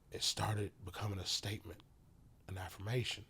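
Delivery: usual speaking voice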